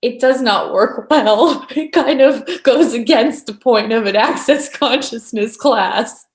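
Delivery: real laughter breaking into the words; amused. Real, not acted. Teacher joking about classes made from misery, amused. Amused at her own absurd point.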